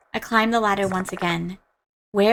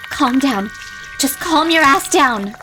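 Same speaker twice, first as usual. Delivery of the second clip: telling myself to calm down; 5 semitones higher, 5 dB louder, slower than usual. Real, not acted. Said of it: "So I say to myself".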